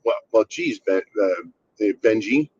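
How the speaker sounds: usual speaking voice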